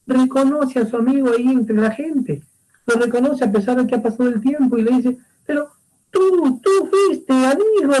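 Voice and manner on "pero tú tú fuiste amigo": slow, much higher cry of recognition; excited recognition. Acted. Messenger recognizes the shepherd among people, slow high cry. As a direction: spot an old friend in the crowd.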